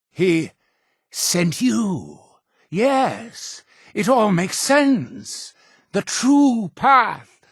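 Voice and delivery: high, drawn-out awe; awed revelation. Acted. Cult leader realizing a follower was sent by Atom, awed. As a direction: let a dawning revelation lift the voice.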